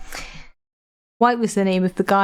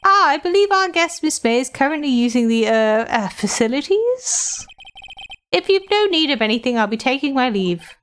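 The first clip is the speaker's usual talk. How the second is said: fawning hotel bellboy, voice raised high; polite bellboy; 4 semitones higher than usual. Acted. Bellboy character voice.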